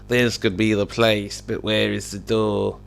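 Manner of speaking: slow, soft put-on character voice; Sir Pixel. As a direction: speak slowly in an odd, subdued character voice. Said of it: "Sir Pixel exclaimed".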